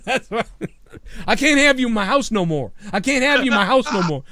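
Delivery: high, laughing repetition; giggly imitation of a host; laughing mock host. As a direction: quote it while cracking up.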